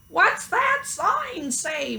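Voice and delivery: high, slow curious cow voice; puzzled cow squinting at a sign; cow asking. A narrator's reading. Mabel the cow asking, high and slow. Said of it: "Mabel asked".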